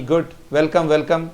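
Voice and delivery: normal talking voice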